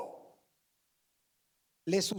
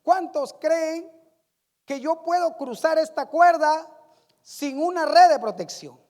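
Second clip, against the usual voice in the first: shouting from high up; 3 semitones higher, 6 dB louder than usual. Acted. Acróbata desde la cuerda desafía al público, gritando. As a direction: call out a dare to the crowd below. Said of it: "y desde arriba gritó".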